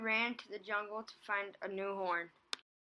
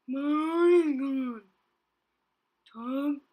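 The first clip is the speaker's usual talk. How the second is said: loud sobbing rhino; crying; 6 semitones higher, 12 dB louder, slower than usual. A narrator's reading. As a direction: sob it out loud. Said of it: "he cried".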